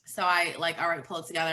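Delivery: ordinary talk, nothing marked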